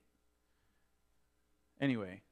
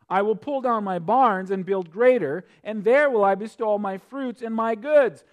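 preaching scripture; 6 semitones higher, 8 dB louder than usual. Acted. Preacher reading the rich fool's words to the congregation, raised and proclaiming. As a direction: proclaim the verses with full force.

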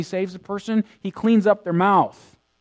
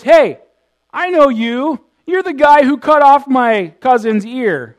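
raised, high-pitched confrontation; loud accusation; 9 semitones higher, 11 dB louder than usual. Acted. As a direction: confront him loudly, like an angry relative. Acting out relative accusing Peter.

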